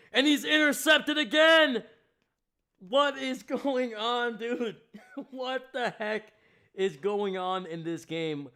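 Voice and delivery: rising to a high, loud disbelieving shout; stunned disbelief. Real, not acted. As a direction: shout it out in shock, stretching it. Stunned by another interception.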